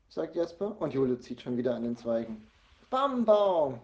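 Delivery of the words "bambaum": put-on high, loud toddler cry; little child's loud call. A narrator's reading. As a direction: crow the word out. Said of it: "ruft sie".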